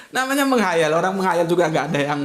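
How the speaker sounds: ordinary talk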